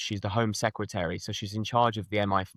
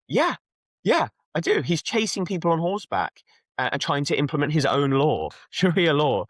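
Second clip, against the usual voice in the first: put-on higher-pitched, insistent Manchester accent; 5 semitones higher than usual. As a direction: imitate a protesting Manchester man, pitched up. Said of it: "gonna do my best Manchester accent".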